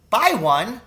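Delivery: put-on boy stunned by father's plan, crying out; cried. A narrator's reading. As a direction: blurt it out in disbelief. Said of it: "I cried".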